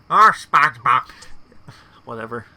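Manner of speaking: loud annoyed cartoon neighbour; Squidward impression. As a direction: push the exasperation harder. Repeats Squidward's exasperated line.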